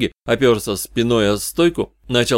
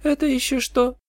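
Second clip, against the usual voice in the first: much higher-pitched, wary question; suspicious. A narrator's reading. Katie eyes the detective, suspicious question. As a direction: question him with suspicion, voice lifted. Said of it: "посмотрела на меня с подозрением".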